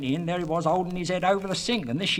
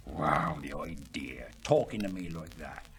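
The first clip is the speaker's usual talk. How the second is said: lower, muttered indignation; indignant aside; 10 semitones lower, 5 dB quieter than usual. Acted. A villager grumbling about a rude neighbour, indignant. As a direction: grumble it under your breath, offended.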